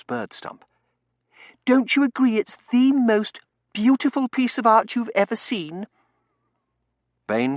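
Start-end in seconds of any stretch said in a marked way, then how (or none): 1.6-5.9 put-on high, loud young lady's gush; gushing Tossie. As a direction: voice an excitable miss, pitched right up.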